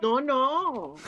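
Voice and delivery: normal talking voice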